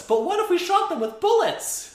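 high, loud hammed-up pitch; over-excited mocking yell; mock bright idea. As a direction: shout it like a brilliant plan. Mocking the police's useless idea.

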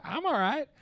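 high-pitched Southern twang impression; imitated Southern accent. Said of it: "You're like".

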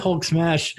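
laughing. Pretend. Jokes Hulk catchphrase laughing.

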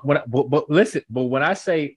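usual speaking voice

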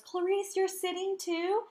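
high, bright mock-cheerful call; mock eager greeting. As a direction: mimic an overeager guy calling out. Imitating his eager hallway greeting.

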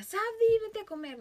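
high loud call of her name, then dropping; mock call from mom. Mom calling her daughter to lunch, mock yell. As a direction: call the child to come and eat. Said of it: "mi mamá me grita".

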